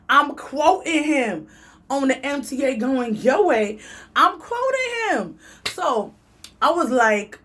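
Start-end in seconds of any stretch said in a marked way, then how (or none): 0.1-1.5 dragged out word by word; slow down to stress it; slow emphatic. Real, not acted. Insisting she quotes the man, slow and deliberate. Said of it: "I'm quoting this". 4.1-5.4 way up high and drawn out; high slow insistence. Real, not acted. Repeating that she quotes him, high and slow. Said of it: "I'm quoting this".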